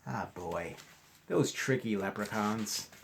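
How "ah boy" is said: usual speaking voice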